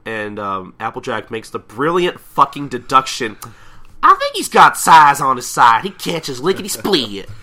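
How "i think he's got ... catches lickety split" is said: loud, high acted pony voice; goofy cartoon voice. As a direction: blurt out a dim deduction, loudly. Voicing pony's silly deduction.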